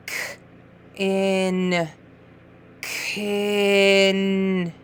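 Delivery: Kin stretched, long k and held n; slid sounds. Shown as a demonstration. Teacher modelling sliding for a reader, Kin stretched out. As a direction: slide slowly from K through to the end of Kin. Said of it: "Get that finger and slide".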